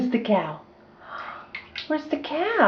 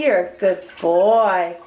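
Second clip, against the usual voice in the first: ordinary delivery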